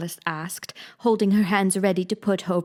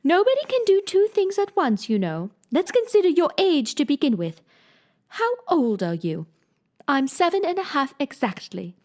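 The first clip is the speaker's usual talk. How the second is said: lightly voiced queen, insisting; 7 semitones higher than usual. Queen to Alice, lightly voiced fussy queen.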